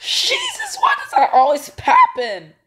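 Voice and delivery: high panicked shriek; frightened outburst; scared cry. Real, not acted. Jump scare.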